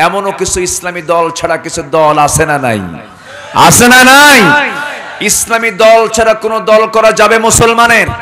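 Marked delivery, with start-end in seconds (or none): 3.7-8.1 loud, high, slowing preacher's shout; preacher hammering হারাম at the congregation; fiery preaching. Acted. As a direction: thunder it at the crowd. Fiery sermon to a crowd.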